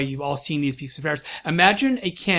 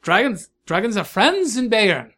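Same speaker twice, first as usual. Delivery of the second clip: high, loud-edged German lilt; German accent; 5 semitones higher, 6 dB louder than usual. Acted. Host telling dragon about Bavarian friends, German accent. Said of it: "he's switched languages from German".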